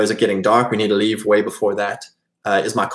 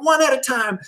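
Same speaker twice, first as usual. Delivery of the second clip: much higher barked order; retold official's shout; 12 semitones higher than usual. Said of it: "She's, like, shouting at them".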